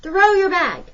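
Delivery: high, loud yell over the noise of the train; desperate scream, slow and loud. A narrator's reading. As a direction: shout it to a friend running behind the car. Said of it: "He screamed".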